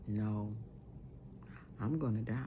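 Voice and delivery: soft, low voice imitating the dying woman; retold dying words. Dying woman tells them she is going, retold softly and low.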